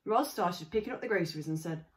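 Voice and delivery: usual speaking voice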